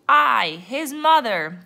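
loud pirate crew echo; crew chorus. A narrator's reading. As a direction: chime in loudly as the crew. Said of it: "the crew chorused".

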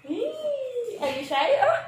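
high squeal, then teasing. Real, not acted. Teasing shy baby. (matinis na panunukso sa mahiyaing anak)

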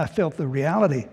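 ordinary delivery

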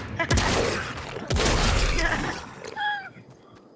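high-pitched cackling laugh; high laughter. Real, not acted. Laughing at friend's kill.

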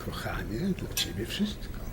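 soft, hushed romantic murmur; tender whisper. Acted. Husband to wife after her tease, a hushed tender promise. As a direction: breathe it like a sweet nothing.

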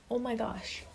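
a hushed, breathy oh my gosh; soft aside. Real, not acted. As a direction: say it softly to yourself, almost swooning. Swooning over uniforms.

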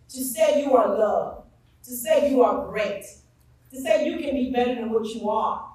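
rally the students like a pulpit call; rousing speech. Acted.

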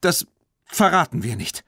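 put-on high-pitched defiant girl's retort; stubborn, high-voiced refusal. A narrator's reading. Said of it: "erwiderte Tina trotzig".

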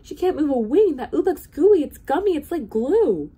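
high, loud, urgent alarm; cried out. A narrator's reading. Bartholomew points out the stuck robin, crying out. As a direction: build to loud, high disgusted dismay. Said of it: "Bartholomew cried".

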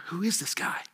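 hushed question among onlookers; murmured wondering. Acted. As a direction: wonder it under your breath, still voiced. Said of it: "The whispers".

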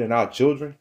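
ordinary delivery

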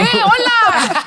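high, loud, laughing hello; playful loud greeting; mock host energy. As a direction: burst in with big podcast energy. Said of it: "¿Qué?".

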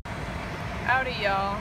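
loud hammy cowboy hello; loud greeting. Acted. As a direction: call out a hammed-up country hello to the camera. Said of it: "greeting the camera loudly".

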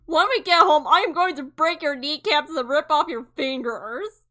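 hammed-up furious threat at Len; furious shout. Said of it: "Show my emotions".

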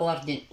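وردن said wardin, with final n; kasr ending as pronounced. Shown as a demonstration. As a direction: end وردن in a sounded -in. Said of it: "هي فقط تنطق وردن".

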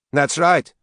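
high, loud, angry retort. A narrator's reading. Slapped daughter cuts into mother's apology, angry. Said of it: "said Katie angrily".

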